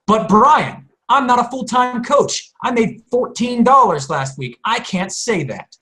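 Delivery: high-pitched whining protest; mock objecting coach. Mimics an objecting coach's complaint.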